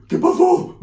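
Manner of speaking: high, startled Spanish exclamation; high startled "¿Qué pasó?"; high paisa voice. Retold or imitated. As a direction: squeak it out like a stunned paisa.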